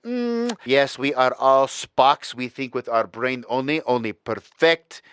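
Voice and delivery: a deliberately terrible German impression of cold logic; hammed-up German accent, robotic and precise; bad German accent. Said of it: "the worst goddamn German accent of all time".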